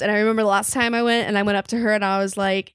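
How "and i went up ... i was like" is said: ordinary talk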